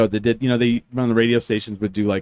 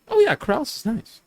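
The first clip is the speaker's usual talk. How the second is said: mock self-imitation; 10 semitones higher than usual. Imitating himself agreeing smugly.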